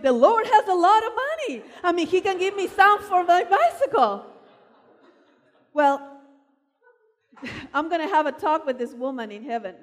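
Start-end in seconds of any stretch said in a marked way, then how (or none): 0.0-4.3 put-on child pleading. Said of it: "And I'm going".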